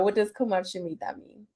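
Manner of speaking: normal talking voice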